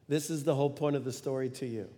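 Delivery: normal talking voice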